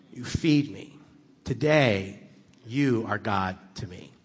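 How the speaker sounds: very slow, measured phrases; slow and earnest. Retold or imitated. Stranger earnestly explains God as kindness, slow. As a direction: spell it out gently.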